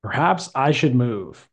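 a teacher's reading aloud for the class to echo; model reading. Shown as a demonstration. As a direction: read it clearly with a careful rise and fall in the voice. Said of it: "listen to the way I say it".

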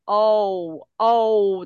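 drawn-out gliding O, like English "oh"; 中文注音式的O. Shown as a demonstration. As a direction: say "O" sliding toward a u sound. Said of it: "我们都是念O，O的这个感觉". (拖长的O，像注音符号那样带滑音)

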